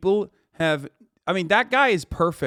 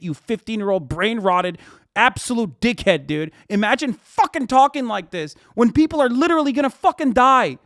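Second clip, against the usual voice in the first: angry outburst; 4 semitones higher, 4 dB louder than usual. Real, not acted. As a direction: voice climbing into a furious yell. Ranting at a chat user.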